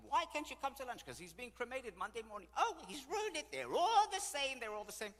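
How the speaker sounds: high, loud back-and-forth griping; bickering friends. Retold or imitated. Friends arguing over lunch and cremation, bickering. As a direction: replay old ladies squabbling in outrage.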